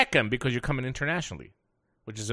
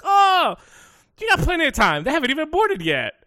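high, bright chirpy reassurance; cheerful counter agent; 13 semitones higher, 5 dB louder than usual. Retold or imitated. Counter agent reassuring the rushed traveller, chirpy and high. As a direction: sing it out like a breezy clerk.

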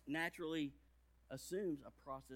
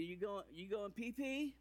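put-on toddler asking; 5 semitones higher than usual. Daughter asking through the door.

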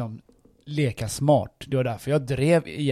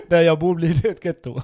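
laughing; 4 semitones higher, 6 dB louder than usual. Real, not acted.

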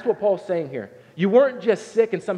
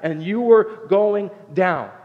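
slow emphasis; 3 semitones higher, 4 dB louder, much slower than usual. Acted. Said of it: "He said".

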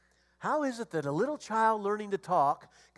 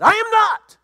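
high, loud indignant shout; mock angry denial; 16 dB louder than usual. Pastor acting his denial to the counselor, loud mock shout. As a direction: bellow the denial to prove him wrong.

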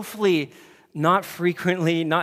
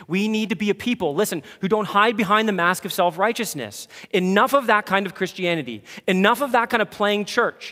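louder, higher, rallying sermon push; preaching at full force; 6 semitones higher, 6 dB louder than usual. Acted. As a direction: call the church out firmly and loudly.

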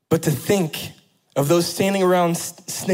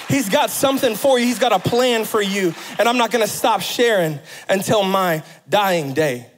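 full-force preaching; 7 semitones higher than usual. Acted. Pastor urging his church to speak out, preaching hard. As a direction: drive every phrase up to the congregation.

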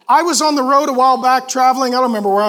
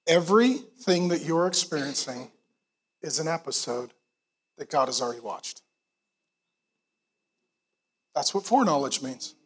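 much softer, low, sincere; hushed reverence; 10 semitones lower, 10 dB quieter than usual. Real, not acted. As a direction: let it land gently.

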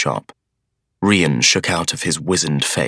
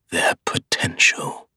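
scheming whisper; slower than usual. A narrator's reading. Scheming brother tempts.

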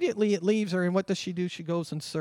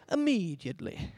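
English accent; 3 dB quieter than usual. Shown as a demonstration. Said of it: "I just like to say".

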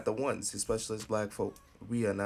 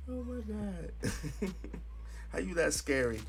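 laughing disbelief; 10 semitones higher than usual. Real, not acted. Laughing disbelief at Roger Stone.

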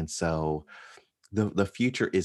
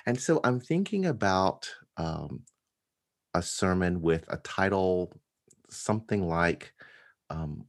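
normal talking voice

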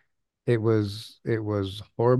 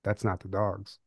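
much softer, muttered to himself; quiet inner thought; 3 semitones lower, 9 dB quieter than usual. Retold or imitated. Him realizing at the stair bottom, softly to himself. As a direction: say it under the breath as he realizes. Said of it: "thinking to myself".

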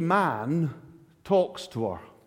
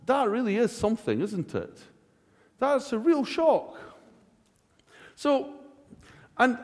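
ordinary delivery

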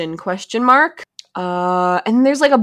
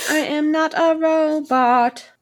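slow, higher-pitched robot; 6 semitones higher, slower than usual. Pretend. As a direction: deny it like a robot. Robot voice denial.

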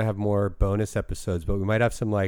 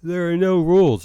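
said through a yawn; 7 semitones higher, 6 dB louder than usual. Real, not acted. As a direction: stretch it out mid-yawn. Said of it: "I'm sorry, I keep yawning".

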